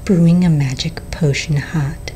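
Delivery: ordinary delivery, nothing marked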